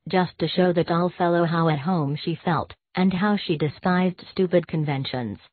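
usual speaking voice

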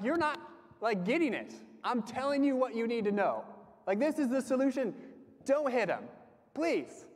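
mock the exasperated parent who won't stop lecturing; frustrated dad. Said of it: "I'm getting frustrated because I'm like".

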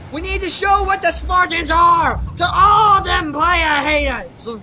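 shouting rallying cry; battle cry. Acted. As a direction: roar it to the haters.

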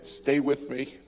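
steady reassuring voice. Retold or imitated. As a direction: hold him steady through the storm. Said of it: "All I can remember is that voice saying".

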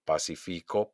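low "pacifico", weight on FI; stress on FI. Shown as a demonstration. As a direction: lean on FI in "pacifico". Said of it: "hago un golpe de voz". ("pacifico" acentuado en FI)

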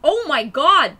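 loud, high exclamation; big shocked exclamation; astonished outburst. Real, not acted. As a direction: cry out in sudden realisation. Realises who Todd is.

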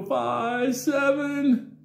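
high, loud halting count; high counting boy. Retold or imitated. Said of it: "he'd go".

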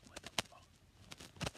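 slow breathy whispered curse. Real, not acted. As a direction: whisper it in disbelief. Late night exhausted muttering.

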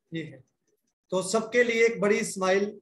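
normal talking voice

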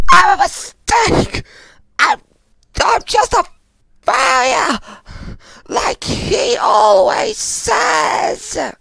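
very high, broken sobbing shout; crying breakdown. Acted. Blaming self as a failure to a friend, crying out. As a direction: scream it out through tears, halting between words. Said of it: "I don't want you to cry anymore".

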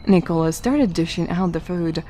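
ordinary delivery

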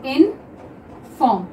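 Inform stretched out slowly; slow drawn-out reading. Shown as a demonstration. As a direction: draw out inform for the class. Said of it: "एक है inform". (Inform को धीरे-धीरे)

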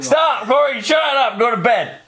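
a playful telling-off shout; mock shout. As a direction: yell at him to knock it off. Telling off friend who is peeking.

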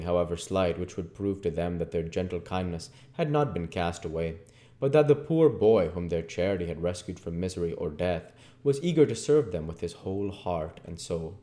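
ordinary delivery

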